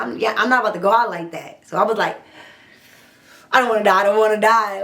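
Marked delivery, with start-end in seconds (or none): 3.5-4.8 fast, panicky pleading; scared pleading. Retold or imitated. Captive begging her attacker not to kill her, fast and panicked. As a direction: beg for your life in a rush. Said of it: "bravery went out the window".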